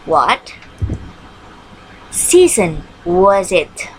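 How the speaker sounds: What season was it, said slowly with a pause after What; drawn out for the class to copy; slow model question. Shown as a demonstration. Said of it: "question word what". (pertanyaan dibaca pelan)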